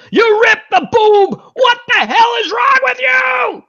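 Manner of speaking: high-pitched, drawn-out furious yell; comic high screech of outrage; outraged yell. Acted. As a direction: yell it high, stretching every word in outrage. Acted angry reproach over ripped page.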